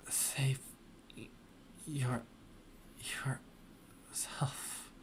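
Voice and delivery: stuttered whisper fading out; dying whisper. Acted. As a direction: force out the last words with no voice. Dying friend's last words.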